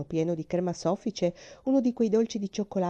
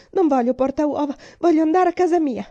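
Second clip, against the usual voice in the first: much higher, louder crying refusal; hysterical refusal; 8 semitones higher, 8 dB louder than usual. Acted. Terrified girl refusing the chocolate mouse, hysterical shout. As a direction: shriek it out in panic.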